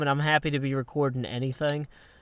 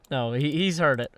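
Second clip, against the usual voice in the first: normal talking voice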